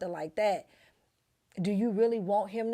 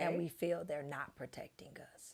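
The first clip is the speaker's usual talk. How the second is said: soft unvoiced whisper; 3 semitones lower, 11 dB quieter than usual. Real, not acted. Wife confiding to friend in a whisper. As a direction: confide it quietly.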